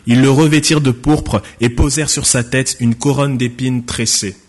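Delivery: ordinary talk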